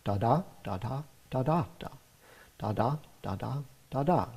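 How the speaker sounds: iambic da-da beats sung out; rhythm demo. Shown as a demonstration. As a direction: tap out the iambic pattern on da-da. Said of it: "The basic rhythm of the poem is".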